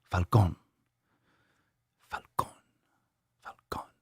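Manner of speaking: "Falcon" in a Spanish accent, fading very soft. Shown as a demonstration. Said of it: "You need that accent".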